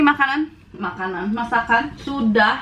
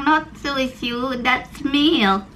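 imitating child; 2 semitones higher, much slower than usual. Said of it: "Dia bilang".

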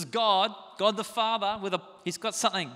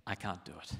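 quiet humble admission; 11 semitones lower, 10 dB quieter than usual. Acted. Humble confession voiced.

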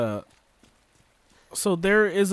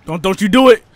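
urgent yell at the screen; loud warning; 2 semitones higher, 10 dB louder than usual. Real, not acted. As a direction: stop her before she does it. Warning a game character.

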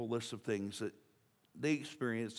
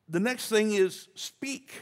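emphatic announcement; 9 semitones higher, 7 dB louder, much slower than usual. Real, not acted. Preacher announcing next point.

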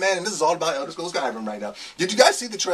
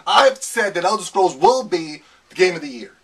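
ordinary talk

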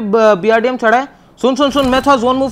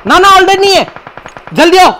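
urgent shout; 6 semitones higher, 4 dB louder than usual. Real, not acted. Teammate alarm during firefight.

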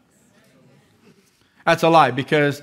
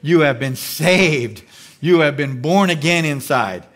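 ordinary talk

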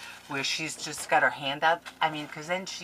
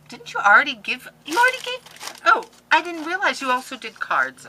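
high, loud startled realization; surprised; 10 semitones higher, 9 dB louder than usual. Real, not acted. Crafter realizes friend also sent handmade cards. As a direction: catch yourself in surprise.

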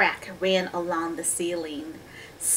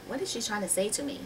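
quick, soft wondering voice; inner thought; 9 dB quieter, much faster than usual. A narrator's reading. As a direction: think it aloud, hushed and puzzled. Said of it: "he's thinking".

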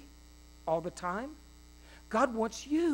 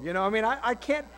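usual speaking voice

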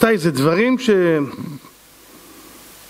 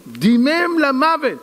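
slow dramatic; 6 semitones higher, much slower than usual. A narrator's reading. Dramatic narration of bleeding.

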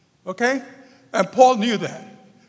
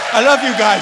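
a louder heartfelt shout of love; loud farewell; 3 semitones higher, 10 dB louder than usual. Real, not acted. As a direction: call it out loudly to the room. Emotional farewell to the audience.

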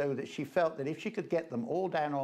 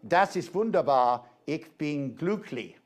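German soldier; 3 semitones higher, 6 dB louder than usual. Retold or imitated. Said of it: "the line comes out".

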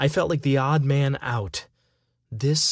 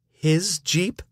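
blurted out; 5 semitones higher than usual. Acted. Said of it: "I blurted out".

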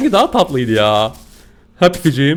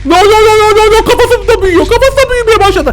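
alarmed cries; 12 semitones higher, 4 dB louder, faster than usual. Real, not acted. Creature grabs and grows.

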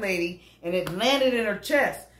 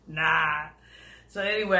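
ordinary talk

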